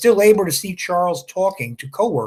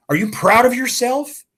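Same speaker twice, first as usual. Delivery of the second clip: indignant challenge; 9 semitones higher than usual. Acted. Elaine confronts Maplewood angrily.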